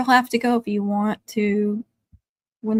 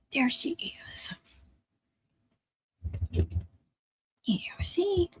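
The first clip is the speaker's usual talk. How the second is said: whisper; 2 semitones higher, 9 dB quieter, slower than usual. Real, not acted. Coaxing dog for viewers.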